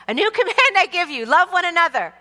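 loud, high-pitched call to the congregation; responsive church reading. Shown as a demonstration. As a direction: belt out the verse for the room to follow. Leading congregation in responsive recitation.

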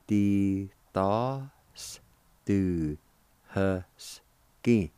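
slow, separated syllables. Shown as a demonstration. Teacher showing learners how jailer is pronounced, syllable by syllable. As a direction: sound it out slowly, pausing between syllables. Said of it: "It's pronounced like this".

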